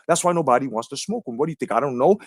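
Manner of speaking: ordinary delivery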